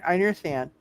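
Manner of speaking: ordinary talk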